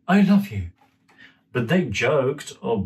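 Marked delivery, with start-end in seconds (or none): 0.1-0.7 put-on high, sweet little boy. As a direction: say it like a small child, high up. Child Dimitri voiced in story.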